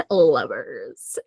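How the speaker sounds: low, drawn-out playful echo; playful drawl. Pretend. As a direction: savour the word in a low, teasing voice. Playful repeat after laughing.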